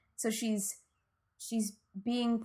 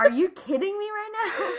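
high, loud, laughing disbelief; exaggerated exasperated squeal, half laughing; mock incredulity; 11 semitones higher, 5 dB louder than usual. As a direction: imitate someone blindsided by a ridiculous question. Retold press secretary's incredulous comeback.

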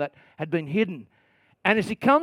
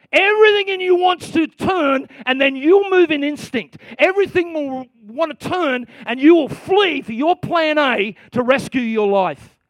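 forceful preaching; 6 semitones higher, 4 dB louder than usual. Real, not acted.

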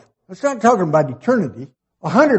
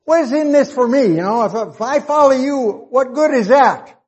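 Peter asking, pitched much higher, half-pleading; voicing Peter; 8 semitones higher than usual. Preacher voicing Peter asking the Lord what's in it for him, raised high and eager. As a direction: lightly play Peter wanting his share. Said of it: "Peter wanted to know what in the world".

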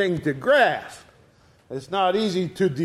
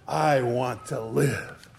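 intense plea; 5 semitones lower, 4 dB quieter, slower than usual. Retold or imitated. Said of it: "how he said it with such intensity, such hope".

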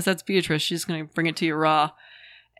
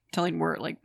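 ordinary talk, nothing marked

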